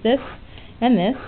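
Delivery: normal talking voice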